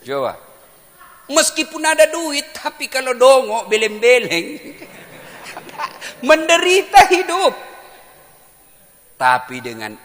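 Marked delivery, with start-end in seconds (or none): 1.3-4.5 high, loud moral; full-force preaching. Acted. Preacher warning that money cannot cure foolishness, full-force preaching. 6.3-7.5 high, very loud preacher's verdict; shouted conclusion. Acted. Preacher concluding on the fool's suffering life, shouted verdict.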